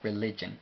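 "Religion" said the English way, ri-LI-jen; English pronunciation. Shown as a demonstration. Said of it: "aunque se pronuncia un poco diferente". ("Religion" pronunciado en inglés, ri-LI-yen)

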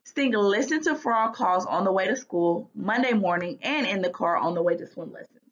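ordinary delivery

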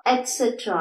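"എറ്റ്സെട്ര" as "et-set-ra"; a t, not k, in "എറ്റ്സെട്ര"; correct pronunciation. Shown as a demonstration. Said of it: "not extra". ("എറ്റ്സെട്ര", എറ്റ് എന്ന് തുടങ്ങി)

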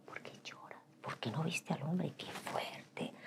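hushed self-scolding whisper; quiet inner interrogation; whispered self-questioning. Retold or imitated. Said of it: "y decía".

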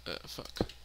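normal talking voice

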